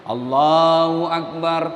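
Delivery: slow low drawn-out takbir; imam's call. Retold or imitated. Imam leading prayer, slow solemn takbir.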